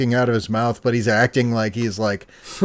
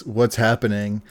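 ordinary delivery

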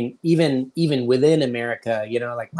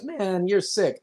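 higher-pitched baffled New Yorker; mock disbelief; 8 semitones higher, slower than usual. New Yorker confused by the apologies, mock disbelief. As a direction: draw back in comic bewilderment, pitch raised.

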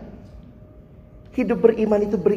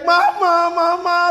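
put-on high, loud child's cry of mama; retelling a child screaming for mama during a shot; 12 semitones higher, 4 dB louder, slower than usual. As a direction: wail mama like a scared toddler. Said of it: "sambil dia teriak".

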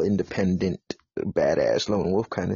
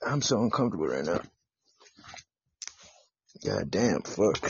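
ordinary talk, nothing marked

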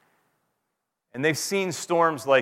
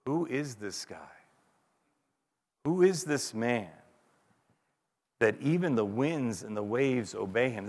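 awestruck; 2 semitones lower, 7 dB quieter than usual. Retold or imitated. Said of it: "the shock and the awe".

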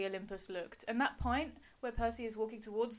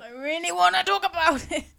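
eager; 9 semitones higher, 12 dB louder than usual. Real, not acted. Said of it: "Beth is itching to do it".

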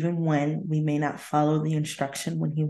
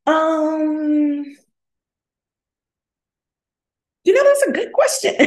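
much higher, fast, squeaky and louder; 10 semitones higher, 6 dB louder than usual. Retold or imitated. Playing herself answering God in a high squeak. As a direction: squeak it out fast like a nervous kid. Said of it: "we talk like this".

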